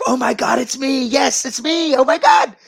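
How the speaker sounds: loud, high-pitched squeal of excitement; so stoked. Retold or imitated. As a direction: shriek it out, imitating someone overjoyed. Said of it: "she was just so stoked".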